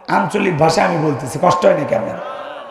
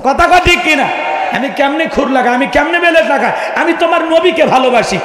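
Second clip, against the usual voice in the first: high-pitched impassioned preaching; fervent declaration; 5 semitones higher than usual. Real, not acted. Preacher declaring he will not shave for love of the Prophet, fervent. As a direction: let devotion push the pitch up.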